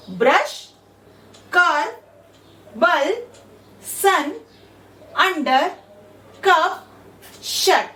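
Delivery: "ब्रश" and "कप" with a short u, each word set apart; clear word demo. Shown as a demonstration. Teacher showing students the short u, word by word. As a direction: give each word its own beat. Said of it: "हम यू को अ ऐसे".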